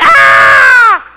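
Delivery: high, loud mock shriek of fright. Preacher acts out fishermen shrieking at a ghost, high loud shriek. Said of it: "they shrieked".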